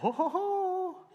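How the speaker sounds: high squeaky laughing oh; delighted oh. Retold or imitated. Seeing his wife's pictures, a high laughing oh. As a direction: let the oh jump up with a laugh. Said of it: "I was like".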